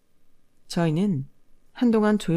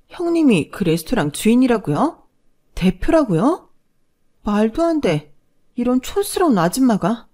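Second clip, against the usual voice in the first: stunned disbelief; 5 semitones higher, 4 dB louder than usual. Acted. Sister-in-law shocked at the news.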